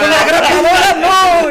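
high, loud, slow mock plea; mock screaming. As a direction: shriek it out as a fake victim. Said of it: "me ponía yo a gritar en el patio". (grito agudo de súplica fingida)

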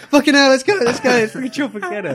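very loud, high, laughing hype; overexcited kids. Retold or imitated. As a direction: yell like a kid gone wild. Overexcited kids running about.

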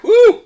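high loud woo against the heat; pained whoop. Real, not acted. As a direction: yelp it out from the burn. Spicy food burning.

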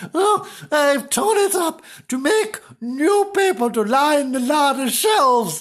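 high, loud character voice; hammed-up domineering wife; scolding wife. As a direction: announce it tartly at high pitch. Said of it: "says Missus Flange".

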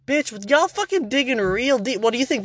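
high-pitched exasperated challenge; mock-confronting the conspiracy diggers; incredulous retort. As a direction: question them in high, fed-up disbelief.

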